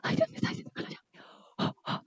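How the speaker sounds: whispered aside to herself; hushed nervous whisper. Retold or imitated. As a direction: whisper it in panic so nobody hears. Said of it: "Y yo".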